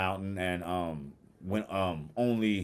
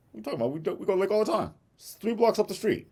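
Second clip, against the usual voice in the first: mock defensive voice, much higher and fast; husband's defensive voice; 12 semitones higher, faster than usual. Husband protests to his wife in a high, quick voice.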